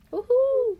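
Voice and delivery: high squealing woohoo; excited cheer. Acted. Cheering about soccer topic.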